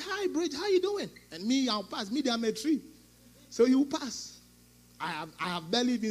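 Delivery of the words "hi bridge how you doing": cheery greeting. Retold or imitated. Lady's cheery greeting imitated.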